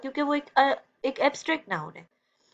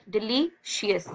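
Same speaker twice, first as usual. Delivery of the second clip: "Delicious" slowly split into syllables; much slower than usual. Shown as a demonstration. Teacher counting breaths for pupils, syllable by syllable "delicious". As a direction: say "delicious" with gaps between its parts. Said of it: "तीन सांसों में पढ़ रहे हो".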